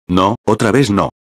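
a mildly annoyed, frustrated groan; understated irritation as it fails again. A narrator's reading. (fastidio leve: otra vez no)

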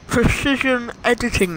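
lisping through a retainer; talking with a retainer in; wearing a retainer. Real, not acted. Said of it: "Can't say it when my retainer".

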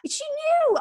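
laughing, high squeak on knew. Real, not acted. Laughing as she teases about the album's timing. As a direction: giggle it out as a knowing tease.

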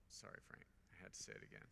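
soft unvoiced apology aside; a quick whispered sorry to Frank. Real, not acted. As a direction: whisper it off to the side.

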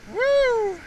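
high joyful woo; excited whoop. Real, not acted. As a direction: whoop with delight. Excited at the catch.

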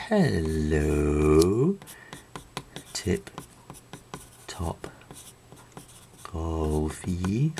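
drawn-out, sing-song greeting voice; terrible silly voice. Shown as a demonstration. Teacher demoing a speech bubble in a silly voice. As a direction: ham up the hello like a cartoon. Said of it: "in quite such a terrible voice".

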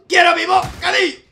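high furious shout; angry demand. Pretend. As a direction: howl it like a tantrum. Rampaging office worker in game.

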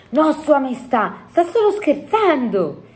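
high-pitched, insistent excuse; protesting little Sofia. A narrator's reading. Sofia excusing the giant to the queen, protesting.

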